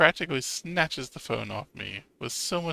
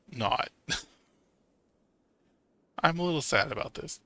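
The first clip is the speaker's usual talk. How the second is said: ordinary talk, nothing marked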